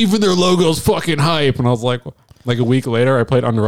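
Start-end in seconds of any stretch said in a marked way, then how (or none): 0.0-1.6 high-pitched excited gush; imitating hyped friend. Friend spotting the band's shirt, imitated excitement. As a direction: gush it high, as a new convert. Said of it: "And he's like".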